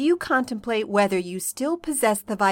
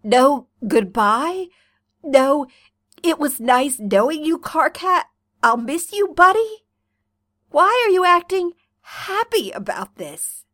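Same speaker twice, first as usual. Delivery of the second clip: indignant rant; 6 semitones higher, 6 dB louder than usual. Acted. Sick troll rants at his friend.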